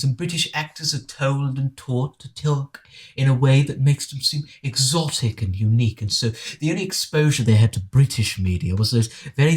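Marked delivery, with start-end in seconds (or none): none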